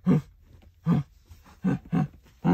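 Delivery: high, teary drugged whine; groggy, refusing food after surgery; groggy from anesthesia. Real, not acted. Drugged, gauze in mouth.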